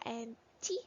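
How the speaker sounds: ordinary delivery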